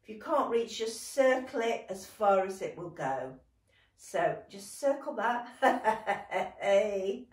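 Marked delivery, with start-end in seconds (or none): 5.5-6.5 short staccato pretend giggle; ticklish laugh. Teacher circling hand over head, pretend ticklish laugh. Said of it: "that's ticklish too".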